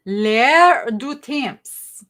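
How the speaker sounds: L'AIR DU TEMPS drawn out slowly in French; tentative French pronunciation. Real, not acted. Attempts the French perfume name, unsure.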